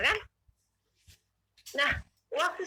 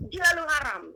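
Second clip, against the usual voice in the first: retold indignant retort, slightly raised; angry; 4 semitones higher, 3 dB louder than usual. Retorting to an invisible voice, mildly angry. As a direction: replay the angry retort with less force. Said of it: "marah sebelum saya ambil Alkitab".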